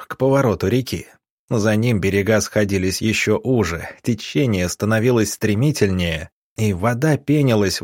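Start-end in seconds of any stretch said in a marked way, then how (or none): none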